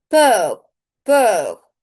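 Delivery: loud word. Shown as a demonstration. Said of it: "teacher says vocabulary word".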